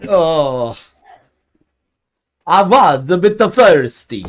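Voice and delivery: put-on slow, lisping vampire accent; sly, slow Dracula-style vampire accent. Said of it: "lisp to do a vampire accent".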